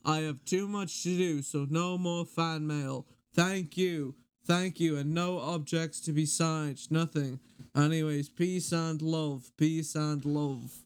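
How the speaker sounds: slow, deliberate peace-and-love voice. Retold or imitated. Reciting his favourite fan-mail video, slow [name] voice. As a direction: warn them slowly with peace and love. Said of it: "I've memorized this".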